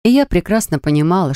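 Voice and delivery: usual speaking voice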